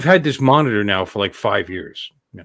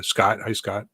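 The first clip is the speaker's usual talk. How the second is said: usual speaking voice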